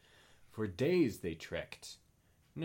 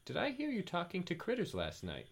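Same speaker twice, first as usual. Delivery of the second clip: groggy Julia voice; 10 semitones higher than usual. Acted. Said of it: "Julia said".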